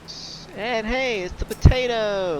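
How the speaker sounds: high, delighted squeal; thrilled to spot a friend; excited. Real, not acted. Spots a potato NPC.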